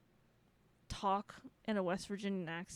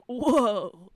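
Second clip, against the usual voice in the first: loud delighted whoa; impressed whoa; 10 dB louder than usual. Retold or imitated. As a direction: gasp out an amazed whoa. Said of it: "I was like".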